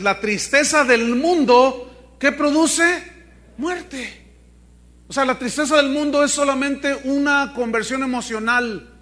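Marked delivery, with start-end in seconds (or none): 0.0-4.2 slow, loud preached verse; emphatic scripture reading. Acted. Preacher proclaims the verse to the congregation, emphatic. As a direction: lean hard on mundo.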